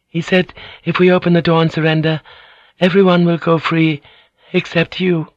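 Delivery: high, tense female voice; steady herself and lie; Mariko's voice. Acted. Mariko voiced lying to Blackthorn.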